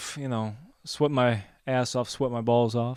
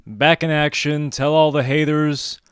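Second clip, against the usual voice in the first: big, loud comeback announcement; hype show intro; 10 dB louder, slower than usual. Acted. Host to audience, we are back, loud hype intro. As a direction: announce the show's return at full volume.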